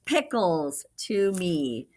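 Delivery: slow, drawn-out reveal; little boy's answer. Acted. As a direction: stretch it out triumphantly. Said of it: "said the little boy".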